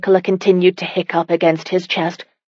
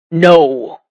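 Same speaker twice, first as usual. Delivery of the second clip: a sharp, louder flat No; forceful denial; 5 dB louder than usual. A narrator's reading. Husband forcefully denying his wife is a traitor, loud. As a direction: slam the denial down. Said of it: "The force in his denial".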